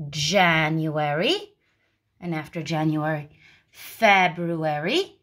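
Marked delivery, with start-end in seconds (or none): 0.1-1.5 "January" drawn out slowly, ending on a clear E; slow model word. Shown as a demonstration. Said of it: "learn it with the E sound". 3.7-5.2 "February" drawn out slowly, ending on a clear E; slow model word. Shown as a demonstration. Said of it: "learn it with the E sound".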